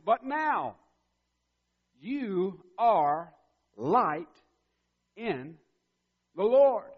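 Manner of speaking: but now, you are light in the Lord, rising high and loud; ringing preacher's climax; preaching loud. Real, not acted. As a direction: proclaim it to the back row.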